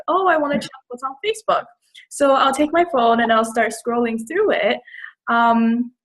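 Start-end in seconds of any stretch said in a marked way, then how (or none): 0.1-1.6 quick, higher-pitched passing thought; impulsive thought. Retold or imitated. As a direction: lightly act out a sudden urge, quicker. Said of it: "I'll be like".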